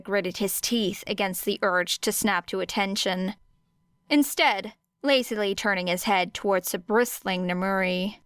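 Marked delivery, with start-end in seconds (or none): none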